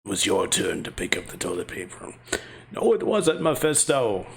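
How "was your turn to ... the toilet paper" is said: much lower, softer demon lord. Acted. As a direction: speak like a brooding demon lord. Voicing Mephisto the demon.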